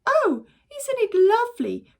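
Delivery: high, loud, thrilled exclaim; delighted cry. Acted. Mother delighted by new nest, high cry. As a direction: gush over the nest. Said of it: "cried Missus Gregg".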